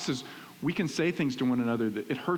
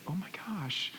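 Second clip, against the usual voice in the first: hushed, higher-pitched pretend shock; mock astonishment; 5 semitones higher, 7 dB quieter than usual. Imagined wife freaked out by husband's book offer, mock astonishment. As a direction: gasp it softly, as if stunned.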